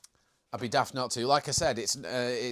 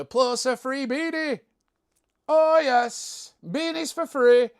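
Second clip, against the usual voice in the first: very high, loud salesman's hype; hammed-up excitement; 10 semitones higher, 8 dB louder, slower than usual. Streamer plugs free beanies to viewers, hammed-up excitement. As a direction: crow about a freebie, playfully overexcited. Hyped-up giveaway pitch to viewers.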